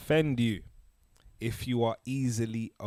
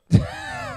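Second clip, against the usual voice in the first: high squeal laugh; 7 dB louder than usual. Real, not acted. Reacting to ten seconds.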